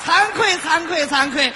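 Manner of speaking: mock modesty. Mock modesty after performance.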